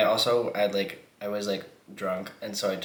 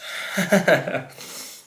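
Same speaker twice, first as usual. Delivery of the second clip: breathless laugh; 10 semitones higher, 6 dB louder than usual. Real, not acted. After the whispered line.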